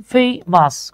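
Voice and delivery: "Famous" slowly broken in two, fay-mas; "famous" with a gap between its two syllables; split into syllables. Shown as a demonstration. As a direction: count out the two parts of "famous". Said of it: "কয়টা অংশ আছে?".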